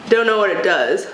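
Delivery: usual speaking voice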